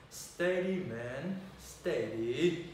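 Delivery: ordinary delivery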